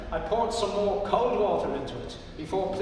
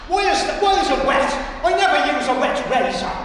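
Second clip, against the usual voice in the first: put-on much higher, louder indignant old man; grumpy complaint; 7 semitones higher, 9 dB louder than usual. Indignant old man. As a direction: Pat complaining the razor is wet. Pat complaining about razor.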